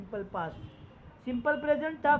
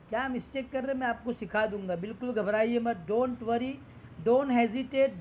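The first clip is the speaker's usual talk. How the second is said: ordinary talk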